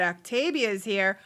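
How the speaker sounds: normal talking voice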